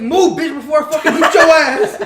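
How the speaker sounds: loud, high mock-furious yell; screaming. Crackhead speeding at a kid on a bike, screaming. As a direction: bellow it high, imitating a raging stranger. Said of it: "screaming at me".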